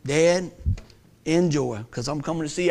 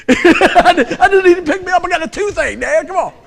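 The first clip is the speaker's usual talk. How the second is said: put-on high, laughing kid's plea to his dad; whiny kid; 10 semitones higher, 7 dB louder than usual. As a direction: play the kid begging dad, giggling. Kid pleading to skip school.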